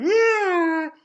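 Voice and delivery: high, loud mock baby wail; hammed-up cry. A narrator's reading. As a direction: imitate a bawling baby bear. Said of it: "He's crying".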